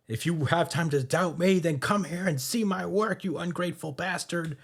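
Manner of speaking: high-pitched, acted upset rebuke; hammed-up hurt anger, pitched well up; tearful outburst. Kizaru lashing out at a doubting comrade, acted tearful outburst. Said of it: "saying this with tears in his eyes".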